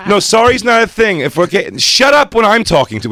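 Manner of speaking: loud, high mock fury; staged angry rant. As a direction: bark at him like a furious friend. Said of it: "I go".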